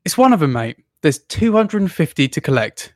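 ordinary delivery

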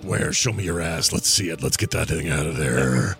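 low mock-serious role voice; played-along helper. Helper offering to pull the bat out, low played-along voice. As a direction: answer in a low put-on voice. Playing along with vampire bit.

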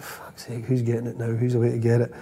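hushed, nervous whisper under the breath; hushed fearful aside among teammates; muttered dread. Retold or imitated. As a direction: mutter it so the boss can't hear. Players dreading the manager's verdict.

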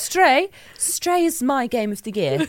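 a lightly voiced posh lady, high. Host naming her game of the year, light posh lady. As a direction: pronounce it like a grand posh lady. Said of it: "This is just a very posh lady".

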